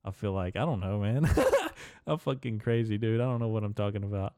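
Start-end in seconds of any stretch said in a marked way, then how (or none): 1.3-2.0 high laugh. Real, not acted. Laughs at own bit.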